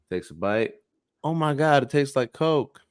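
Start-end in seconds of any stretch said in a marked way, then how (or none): 1.2-2.8 mock amazement, voice lifted higher; amazed reaction. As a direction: play delighted disbelief, lightly. Joke character amazed by second taste.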